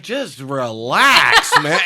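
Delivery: mock cable guy calm; laid-back drawl. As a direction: stretch it out lazily.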